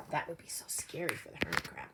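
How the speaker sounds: soft, quick whispered aside; hushed comment about the scared crab. Acted. Aside to children during story.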